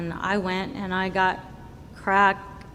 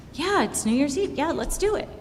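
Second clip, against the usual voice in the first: eager agreement; 7 semitones higher than usual. Retold or imitated. Retelling his eager agreement.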